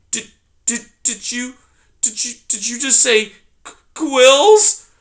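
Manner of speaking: high, halting, terrified mouse; high stammering frightened mouse; stammering in fear. Acted. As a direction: stumble over the words in fright. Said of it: "she stammered".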